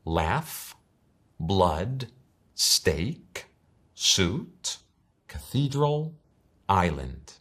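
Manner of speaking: Laugh, blood, steak, suit, cathedral, island each said the English way; English pronunciation. Shown as a demonstration. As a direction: read the word list in clear English. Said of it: "las voy a ir diciendo todas".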